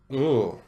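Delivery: a loud, pained ooh of disgust; real wince of disgust, voiced as ooh. Real, not acted. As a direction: recoil with a loud groaning ooh. Disgust at circumcision passage.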